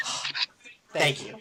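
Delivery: normal talking voice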